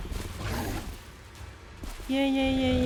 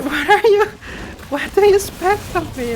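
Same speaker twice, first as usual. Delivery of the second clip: laughing retort; 8 semitones higher, 4 dB louder than usual. Real, not acted.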